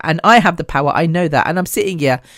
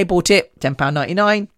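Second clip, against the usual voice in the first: ordinary delivery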